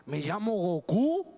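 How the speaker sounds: lightly voiced demon, slow and drawn out; a hint of a possessed girl's demon, slowed down; mildly eerie demon voice, unhurried. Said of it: "el demonio decía".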